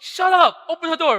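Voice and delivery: very loud, high-pitched outburst; furious shout. Acted. Angry youth teacher silencing the boy behind the door, shouting. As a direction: cut him off with a full yell.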